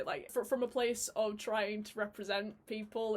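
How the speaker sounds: normal talking voice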